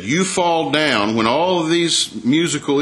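a hint of the haughty king Nebuchadnezzar; slow royal command. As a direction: lay the order down slowly, word by word.

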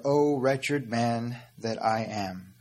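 drawn-out, slow quotation; slow lament. Acted. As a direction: stretch each word like a lament. Quoting Paul's lament again.

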